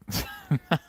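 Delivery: high, breathy burst of laughter; stifled high giggle. Real, not acted. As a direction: crack up helplessly. Cracks up at the quote.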